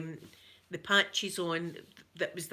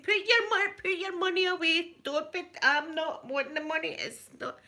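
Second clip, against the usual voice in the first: mock insistent self; 9 semitones higher than usual. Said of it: "I'll be saying".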